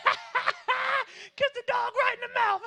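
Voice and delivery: high-pitched squeal through laughter; shrieking, laughing mockery; hammed-up mocking laughter. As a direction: crack up while poking fun at others. Mocking crowd laughing at others.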